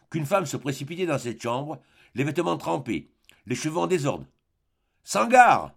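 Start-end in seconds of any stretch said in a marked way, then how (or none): 5.0-5.7 a loud, high startled call of a name; sharp cry. A narrator's reading. Ivan crying out to the woman bursting in. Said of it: "s'écria Ivan".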